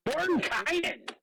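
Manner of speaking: drawn-out, high-pitched cheer; thrilled exclamation; excited outburst. Real, not acted. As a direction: call the name out high and stretched with excitement. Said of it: "So excited!".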